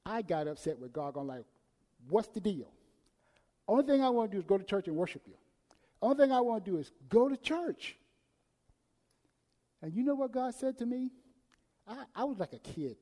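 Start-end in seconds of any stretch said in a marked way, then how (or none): 2.1-8.0 loud, fast, frustrated complaint at God; retold temper tantrum. Preacher acting out his tantrum at God, loud and frustrated. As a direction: rant like a man throwing a tantrum. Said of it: "I had a temper tantrum".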